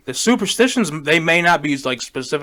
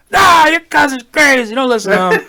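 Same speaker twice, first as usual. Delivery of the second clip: grandfather's voice; 11 semitones higher, 8 dB louder than usual. Retold or imitated. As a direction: play the dismissive old grandfather. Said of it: "he was like".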